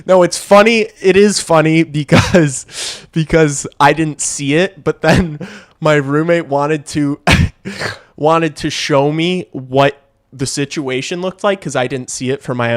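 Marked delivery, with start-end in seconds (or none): 0.0-8.1 giggling through the words; can't hold the laugh in; real laughter while telling the story. Real, not acted. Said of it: "I don't know why I'm laughing".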